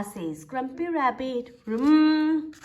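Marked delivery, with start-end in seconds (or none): 1.6-2.5 engine vroom. A narrator's reading. Engine noise of the hovercraft.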